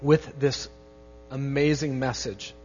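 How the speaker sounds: soft, hushed prayer to God. Real, not acted. Pastor praying over his congregation, soft and earnest. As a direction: pray quietly with the congregation, gently.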